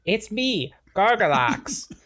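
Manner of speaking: high strange alien voice; alien Gargalax. Acted. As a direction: play the alien dad greeting. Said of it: "Hey, Gargoyle".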